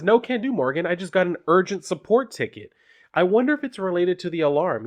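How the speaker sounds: high, bright-eyed keen voice; she's too keen on the ticket to leave; eager young worker. Acted. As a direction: Doyle brushing off Morgan, pitched well up. Doyle voiced in comic reading.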